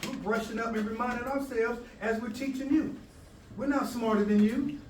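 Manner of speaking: normal talking voice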